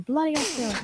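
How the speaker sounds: badly faked Aussie accent, tossed off quietly; bad Australian accent. Co-host trying out a viewer's nationality, bad Australian accent. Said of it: "I can't do an Australian accent".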